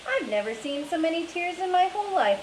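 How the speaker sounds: lightly voiced father in wonder; father's amazed line. A narrator's reading. Father marvels at his daughter's tears, amazed.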